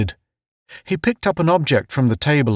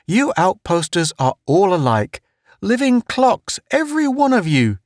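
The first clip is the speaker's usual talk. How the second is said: much higher, jovial ribbing; Town's teasing voice; 10 semitones higher than usual. Acted. Town mocks the outposters as living clocks, high and jolly. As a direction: rib them in a high, jolly voice.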